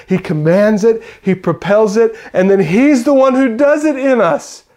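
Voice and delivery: loud, high, ringing preacher's voice; impassioned sermon climax; full-force preaching. Acted. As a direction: preach it out to the whole room.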